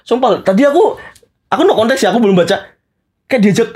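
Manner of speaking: ordinary talk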